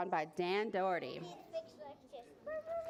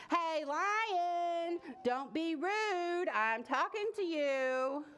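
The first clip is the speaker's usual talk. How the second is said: slow, drawn-out calling, raised only a little; mild storybook scream; 6 semitones higher, much slower than usual. A narrator's reading. Boy scolding a lion that ignores him, mild mock scream. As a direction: call out insistently, stretching each word. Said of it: "the boy screamed".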